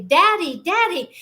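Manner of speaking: put-on high, loud child's call to her father. Little girl calling to her daddy on the stairs. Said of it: "I run out my door and I say".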